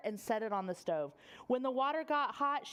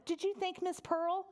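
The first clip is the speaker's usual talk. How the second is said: mother's voice; 8 semitones higher than usual. Acted. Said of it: "His mother said".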